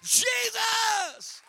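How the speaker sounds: high, loud call of the name; shouted Jesus. Shown as a demonstration. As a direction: shout it out for them to repeat. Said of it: "Come on, somebody say that".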